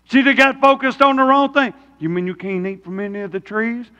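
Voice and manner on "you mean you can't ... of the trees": Satan's quiet question. Retold or imitated. Satan voiced in sermon.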